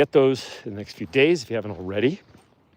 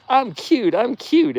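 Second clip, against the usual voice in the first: much higher, tiny sing-song cute voice; cutesy wolf voice; 12 semitones higher, 5 dB louder, slower than usual. Pretend. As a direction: squeak it like an adorable pet begging. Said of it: "Mooney that says".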